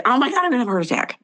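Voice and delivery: fast, hammed-up panic; playing the drama queen who cries wolf; mock dramatic panic. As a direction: rattle off a fake alarm. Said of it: "I can be a little bit dramatic".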